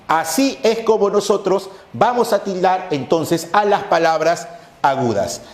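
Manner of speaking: normal talking voice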